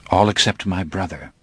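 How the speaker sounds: usual speaking voice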